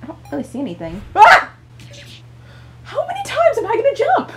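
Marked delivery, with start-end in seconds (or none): 1.1-1.5 high, loud yelp of fright; startled scream. Real, not acted. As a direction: jolt and cry out. Jump scare, how many times jump.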